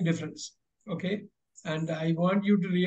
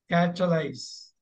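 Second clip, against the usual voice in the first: slow careful model word; slower than usual. Shown as a demonstration. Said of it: "And the chemical word".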